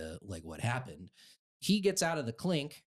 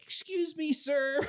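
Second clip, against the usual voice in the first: high-pitched, attention-getting call; calling to an officer; 4 dB louder than usual. Retold or imitated. Teen calls to a police officer outside, high-pitched call.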